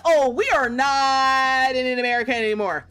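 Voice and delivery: hammed-up traveller's panic; mock dramatic. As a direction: play up the shock of being lost. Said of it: "I was like".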